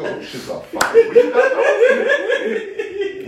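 high, slow, laughing mock-admiring voice; mock friendly compliment. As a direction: gush over his sneakers in a high put-on voice. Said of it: "I'm like".

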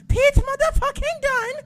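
high, loud mock British shriek; UK fan impression. Shown as a demonstration. Correcting the plain name, high British fan yell. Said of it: "No, it's".

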